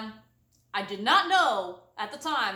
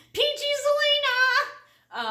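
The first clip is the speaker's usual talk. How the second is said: high, loud, slow proclaim; mock exclamation; 6 dB louder, slower than usual. Scolding herself for swearing, a loud slow announcement. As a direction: announce it like a catchphrase.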